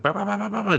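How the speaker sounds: high, soft mock gunshots; imitated gunfire. Peter Vincent unloads the round, mimicked shots. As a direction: spit out rapid pretend shots. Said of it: "He's just like".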